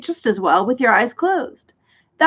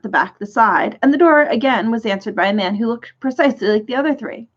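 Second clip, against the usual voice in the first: plain reading voice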